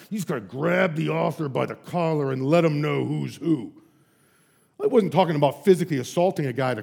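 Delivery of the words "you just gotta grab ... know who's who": mock tough guy. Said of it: "tough guy voice".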